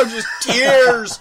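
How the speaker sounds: high, loud laugh into just tears; laughing at own tears. Real, not acted. Admitting to a friend he cries at the film, loud high laugh. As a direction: laugh at yourself for crying. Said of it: "And I'm".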